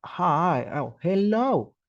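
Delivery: mock stiff, high and slow formal hello; overly formal greeting. Shown as a demonstration. Teacher mocking a too formal hello to friends. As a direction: ham up a stiff polite greeting. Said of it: "no vaya ahí a, a llegar como".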